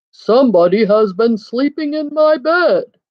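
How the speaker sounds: booming Papa Bear; great big voice. A narrator's reading. Papa Bear finds his bed slept in, great big voice. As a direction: put on a big storybook bear voice. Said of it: "in his great big voice".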